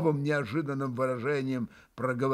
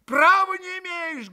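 much higher, louder, fervent; hot shout; 5 dB louder than usual. A narrator's reading. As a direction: shout at your brother hotly. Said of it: "горячо крикнул на брата Алёша".

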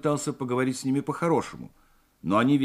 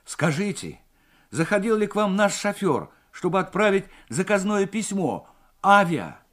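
higher-pitched anxious inquiry; agitated; 7 semitones higher, 4 dB louder than usual. A narrator's reading. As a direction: press the operator for news, agitated. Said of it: "взволнованно произнёс Морев". (взволнованно, с тревогой)

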